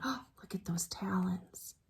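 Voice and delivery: awed whisper. Real, not acted. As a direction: whisper it in wonder. Whispered aside to children.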